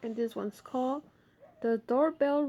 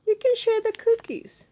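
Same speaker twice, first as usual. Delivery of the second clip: Ma's voice; 13 semitones higher, 9 dB louder than usual. Acted. Ma voiced in story.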